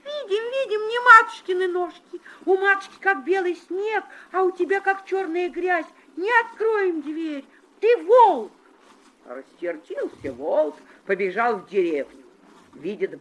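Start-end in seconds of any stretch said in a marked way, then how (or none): 0.0-8.5 put-on high, loud children's shout; shouting kids. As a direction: kids yelling high at the wolf. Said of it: "да как закричат".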